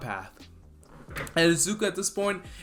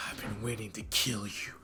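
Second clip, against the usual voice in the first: menacing Dabi; 4 semitones lower, 11 dB quieter than usual. Retold or imitated. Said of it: "And he's like".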